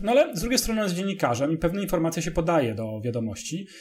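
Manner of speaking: usual speaking voice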